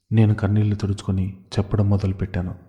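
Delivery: ordinary delivery, nothing marked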